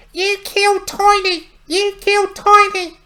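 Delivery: very high, loud squeaky imp shriek; furious imp. Acted. Imp screeching at Harmug over its dead friend Tiny, high and furious. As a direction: shriek the accusation like an enraged little goblin.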